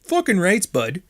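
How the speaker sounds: rough-talking Canadian accent, mock-hearty. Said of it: "vulgar and rednecky".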